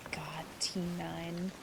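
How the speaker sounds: breathy whisper, almost no voice; whispered aside. Real, not acted. Whispering fondly to chat about T nine. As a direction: breathe it out like a fond memory.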